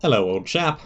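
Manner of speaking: a lightly put-on British accent, rising on hello; cheery British greeting in a light put-on accent; a touch of British toff saying hello. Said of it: "Yes, you're definitely British".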